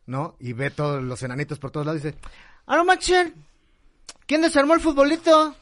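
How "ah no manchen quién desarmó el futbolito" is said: put-on high, loud drunk exclaiming. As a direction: squeal it like a stunned drunk. Drunk seeing dwarfs, joke punchline.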